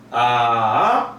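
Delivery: 아 dipping low then rising; third tone. Shown as a demonstration. Teacher running through the tones, third one dipping. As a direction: dip 아 low before it rises. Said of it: "일성부터 사성까지".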